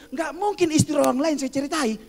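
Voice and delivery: high-pitched mock retort to the wife. Preacher answers wife about sermon stories, retorting. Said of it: "saya bilang sama dia".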